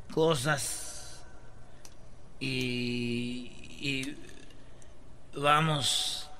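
low, halting politician with very long pauses; Obrador. Acted. Answering co-hosts' teasing with a halting Obrador imitation. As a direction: drag it out word by word, low and softening. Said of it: "está hablando como Obrador este".

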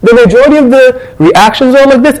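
ordinary talk, nothing marked

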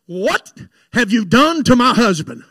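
loud retold demand, broken after 'what'; indignant wife. Wife marching into the hospital room, indignant demand. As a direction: imitate an outraged wife confronting the staff.

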